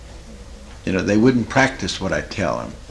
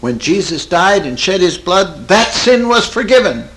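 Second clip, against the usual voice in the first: high mock yell, building louder; retold scream; 8 semitones higher, 5 dB louder than usual. Woman in wheat fields proclaiming forgiveness, retold scream. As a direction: imitate shouting down guilt, rising to the end. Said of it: "scream it to the top of her voice".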